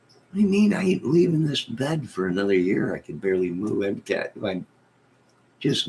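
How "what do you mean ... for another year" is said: high, loud mock outrage; indignant refusal. To the nurses, refusing to leave bed, high indignant whine. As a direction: squeal it like you've been insulted.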